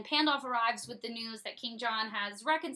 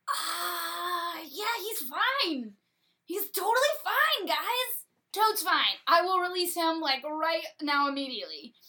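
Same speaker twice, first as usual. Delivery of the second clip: nervous lying King John; 6 semitones higher, 4 dB louder than usual. Retold or imitated. King John lying to his lords, high and nervous. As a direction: cover up the lie way too eagerly. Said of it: "King John is like".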